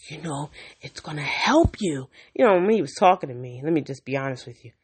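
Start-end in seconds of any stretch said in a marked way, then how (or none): 0.1-2.1 whispered mimicry of the doctor; mock surgeon whisper. Patient mimicking surgeon's reassurance, whispered. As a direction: breathe it out like a dismissive doctor.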